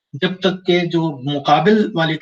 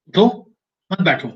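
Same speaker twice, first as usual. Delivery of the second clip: उठो on its own, then मत बैठो as one unit; pause after उठो. Shown as a demonstration. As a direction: split it after उठो with a clear break. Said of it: "पढ़ने वाला अगर इसको इस तरह पढ़ता है".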